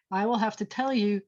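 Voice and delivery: usual speaking voice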